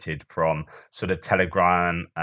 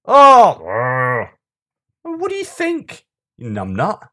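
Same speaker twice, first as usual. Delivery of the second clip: exasperated; 7 semitones higher, 8 dB louder, slower than usual. Real, not acted. Exasperated outburst at the holidaymaker.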